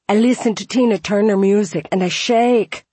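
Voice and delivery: high, loud Scottish man; Scottish accent. Retold or imitated. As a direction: exuberant Scottish voice. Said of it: "He had a thick Scottish accent".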